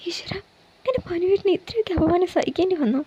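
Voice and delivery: high, hushed, near-sobbing; breaking into tears. Acted. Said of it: "അവൾ പൊട്ടിക്കരഞ്ഞു". (കരച്ചിലിലേക്ക് വീഴുന്ന പതിഞ്ഞ ശബ്ദം)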